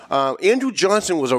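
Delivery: normal talking voice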